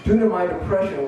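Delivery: ordinary delivery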